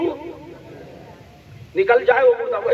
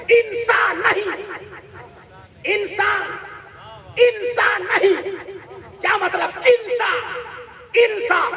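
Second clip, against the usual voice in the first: declaimed verse; 4 semitones higher than usual. Real, not acted. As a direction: recite the couplet to the crowd, stretching each phrase. Repeats verse to the congregation. (ठहर-ठहर कर जोश से शेर पढ़ना)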